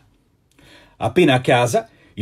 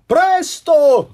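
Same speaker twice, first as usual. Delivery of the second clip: much higher, louder shout; guard shouting; 12 semitones higher, 6 dB louder than usual. A narrator's reading. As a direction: urge the crowd to hurry. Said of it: "gridò la guardia". (la guardia grida, molto più acuta e forte)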